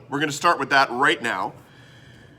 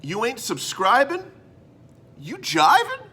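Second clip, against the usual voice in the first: higher, sing-song catchphrase; jive slogan; 9 semitones higher than usual. Acted. Host pitches his new motto, playful jive voice. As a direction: sell the rhyme like a jive hype man. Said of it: "I came up with this beauty".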